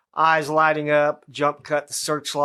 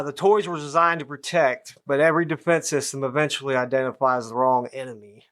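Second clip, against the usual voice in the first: ordinary talk, nothing marked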